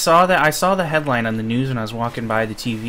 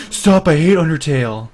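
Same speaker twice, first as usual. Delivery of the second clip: loud, high imitated outburst; mock protest; 4 semitones higher, 7 dB louder than usual. Retelling a friend's reply to being called Undyne, loud mock protest. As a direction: imitate a friend loudly objecting. Acted retort from friend in anecdote.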